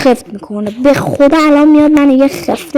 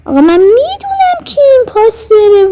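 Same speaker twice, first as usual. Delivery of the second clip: excited; 9 semitones higher than usual. Real, not acted. Excitedly claims to know impostor.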